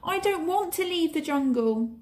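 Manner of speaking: high whining refusal; protesting boy. A narrator's reading. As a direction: refuse like a stubborn child. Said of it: "protested Mowgli".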